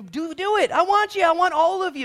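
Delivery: high, loud, pleading cry; impassioned plea. Retold or imitated. Preacher voicing Jeremy's prayer, high impassioned plea. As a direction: beg with rising passion.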